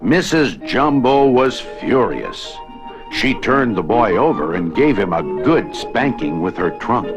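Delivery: ordinary delivery, nothing marked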